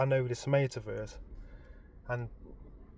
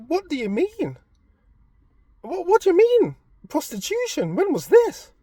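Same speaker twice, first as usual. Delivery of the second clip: high, louder what do you mean; incredulous; 7 dB louder than usual. Retold or imitated. Husband demanding his wife explain, high incredulous voice. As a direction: demand an answer in disbelief. Retelling his disbelief loudly.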